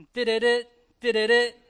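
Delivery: imitated TV jingle, punched and staccato; sports channel jingle. Pastor imitating the sports channel's TV sound, staccato jingle. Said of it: "It's the sound of E S P N".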